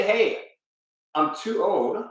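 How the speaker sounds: ordinary talk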